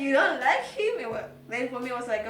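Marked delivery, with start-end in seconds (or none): 0.0-0.9 emphatic question. Retold or imitated. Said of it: "this question".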